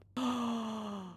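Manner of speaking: a high, sharp gasp of delight; quick delighted gasp. Real, not acted. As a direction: gasp like you just saw something adorable. Spots the cat.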